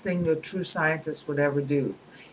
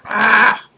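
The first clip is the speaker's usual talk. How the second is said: sudden loud cry of annoyance; exasperated outburst; frustrated yelp; 7 semitones higher, 12 dB louder than usual. Real, not acted. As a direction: blurt out a sharp ah at the glitch. Kindle page keeps jumping.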